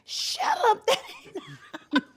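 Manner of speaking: squealing high through a laugh; laughing disbelief. Retold or imitated.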